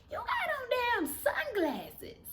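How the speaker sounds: high and slow, full of disbelief; incredulous. Retold or imitated. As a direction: drag it out, baffled and mocking. Mocking sunglasses at night.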